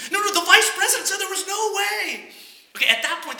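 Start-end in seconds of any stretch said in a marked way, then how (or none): 0.1-2.3 high, louder, laughing protest; loud disbelief. Acted. Customer to bank president, loud high laughing protest. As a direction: push back in laughing disbelief.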